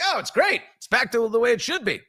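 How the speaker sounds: high, gleeful mock of a rival; a hammed-up gloating coworker. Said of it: "So he'd be like".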